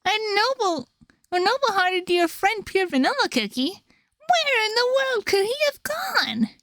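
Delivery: high, mock-sorrowful jester voice; high sly trickster feigning grief; Shadow Milk voice. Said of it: "seems like a Shadow Milk line".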